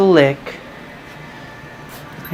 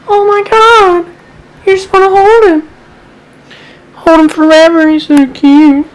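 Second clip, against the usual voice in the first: gushing; 13 semitones higher, 9 dB louder than usual. Real, not acted. Owner gasps and gushes high and loud over her adorable puppy. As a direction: swoon over him, way up high.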